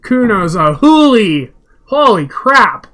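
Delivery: slow, loud stunned exclaim; excited shout. Real, not acted. Player yelling in shock at the roll. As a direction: yell it out in disbelief.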